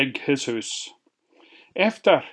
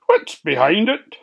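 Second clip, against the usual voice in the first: lightly voiced pig; 6 semitones higher, 4 dB louder than usual. Slavery Sam voiced, eager pig.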